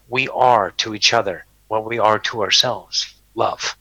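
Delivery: normal talking voice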